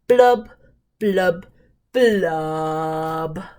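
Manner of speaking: falling glum fish blubs; sad fish blubs. Acted. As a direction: let each blub droop down like a sigh. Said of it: "He's very, very sad".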